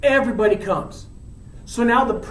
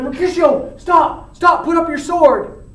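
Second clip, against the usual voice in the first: high, loud plea to stop; urgent calling out; 8 semitones higher, 6 dB louder than usual. Acted. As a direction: act out shouting to break up a fight. Romeo calling to halt fight.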